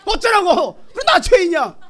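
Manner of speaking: high, loud angry tirade; hammed-up, brazen yelling back; defiant shout. Preacher showing how to rebuke demons, defiant shout.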